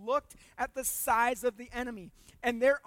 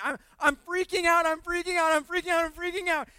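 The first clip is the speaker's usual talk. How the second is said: higher, louder frantic repeating; mock panic; 6 semitones higher, 11 dB louder than usual. Servant panicking at the enemy army, frantic mock panic.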